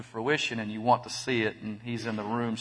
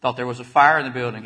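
ordinary talk, nothing marked

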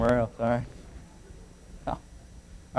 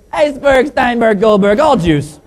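higher put-on Chinese accent; mock Chinese accent; 9 semitones higher, 6 dB louder, slower than usual. Co-pilot topping the pilot, mock Chinese accent. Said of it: "the Chinese guy looks over".

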